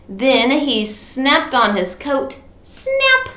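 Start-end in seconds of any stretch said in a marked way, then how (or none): 2.6-3.3 high "Snap!"; snap sound. A narrator's reading. Sound effect word.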